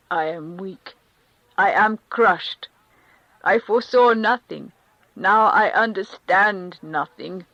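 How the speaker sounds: a man crushed, voice rising loud; distressed Karenin. Acted. Karenin to the Countess, loud and broken. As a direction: cry out his weakness to his friend.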